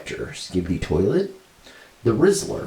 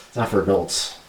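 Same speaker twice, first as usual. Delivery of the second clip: normal talking voice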